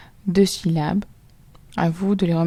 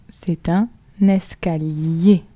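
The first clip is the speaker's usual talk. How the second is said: slow dictation; slower than usual. Shown as a demonstration. Teacher dictates the word.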